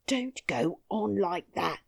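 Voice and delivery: plain reading voice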